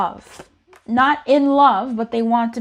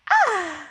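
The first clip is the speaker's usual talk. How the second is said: high, falling little "ah"; innocent goofy "ah"; 9 semitones higher than usual. Acted. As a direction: let out a high, doofy sigh of excitement. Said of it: "When I do the ah sound".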